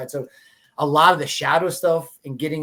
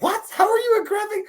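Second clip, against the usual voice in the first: stunned disbelief. Retold or imitated. Retold shocked reaction to designer.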